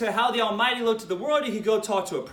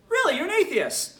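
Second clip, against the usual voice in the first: higher, pointed mock surprise; mock disbelief; 7 semitones higher than usual. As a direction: feign amazement at the claim. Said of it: "And I say to him".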